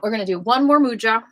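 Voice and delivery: usual speaking voice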